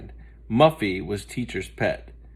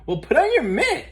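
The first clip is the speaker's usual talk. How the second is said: put-on very high bright girl voice; a happy schoolgirl; cheerful; 4 dB louder than usual. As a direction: tell her gleefully. Muffy confessed, cheery.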